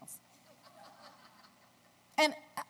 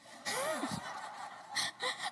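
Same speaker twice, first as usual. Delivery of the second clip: high laugh; 6 dB quieter than usual. Real, not acted. Laughing at husband's wine story.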